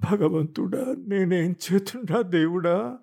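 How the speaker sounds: high, slow mock wail to God; wife wailing to God over her husband's affair, wailing high and slow; wailing lament. Drawn-out wail, pitched high. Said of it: "గొంతెత్తి రాగం తీయటం".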